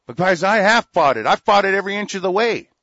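loud, furious insistence; shouting with real anger; real angry shout. Real, not acted. Angry rant peaks.

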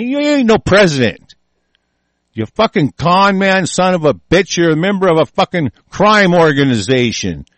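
high, loud insult, slowing on con man; angry shout. Real, not acted. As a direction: yell it straight at him. Cursing the president directly.

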